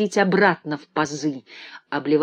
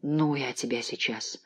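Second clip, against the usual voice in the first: whispered threat through teeth; angry whisper; 4 semitones lower, 9 dB quieter than usual. Acted. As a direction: hiss it at the stuck drawer. Todd fights the stuck drawer.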